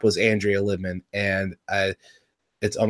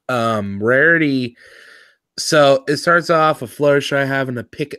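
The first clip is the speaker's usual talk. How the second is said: ordinary talk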